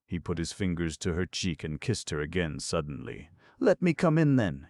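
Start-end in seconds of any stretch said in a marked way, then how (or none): none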